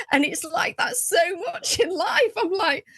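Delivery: usual speaking voice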